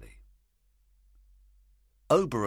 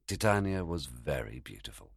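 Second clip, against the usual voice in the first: plain reading voice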